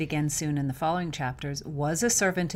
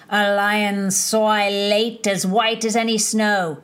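slow, louder poetic declamation; reciting verse; 3 semitones higher, 8 dB louder, slower than usual. Acted. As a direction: recite it like a grand poem, slowly. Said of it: "who began again".

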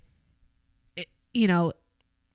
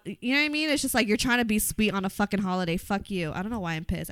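ordinary talk, nothing marked

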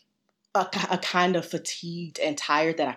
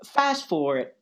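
normal talking voice

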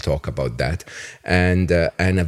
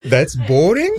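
surprised disbelief; 10 semitones higher, 6 dB louder than usual. Real, not acted. Host reacts to boring childhood claim.

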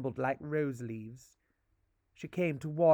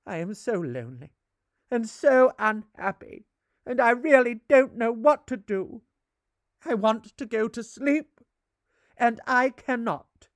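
pleading lament; 7 semitones higher, 7 dB louder than usual. Acted. Lonely ghost pleads.

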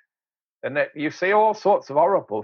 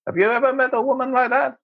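quick, higher mock-scandalized question; incredulous aside; 7 semitones higher, faster than usual.

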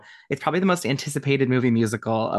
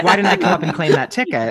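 loud mock envy; 5 semitones higher, 6 dB louder than usual. Mimicking the sister's envy.

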